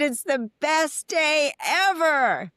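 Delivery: high, slow, stretched-out cheer; exuberant sing-song. Retold or imitated. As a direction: draw out every word like a big joyful shout. Greeting crew on set.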